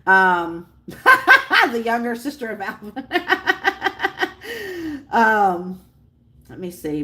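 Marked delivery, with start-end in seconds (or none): 0.9-1.7 high, loud burst of laughter; real laugh. Real, not acted. Streamer amused by a chat comment, high laugh. 3.0-5.0 long, high run of giggles; real giggling. Real, not acted. Streamer tickled by Alvin's sister comment, long high giggle.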